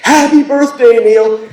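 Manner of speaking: high, loud heckler's call; yelled from the crowd. Retold or imitated. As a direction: holler across a giant concert space.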